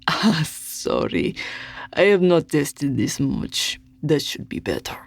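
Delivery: put-on apologetic Russian accent, slowed; sheepish Russian host over a speaker. A narrator's reading. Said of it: "thick with a Russian accent".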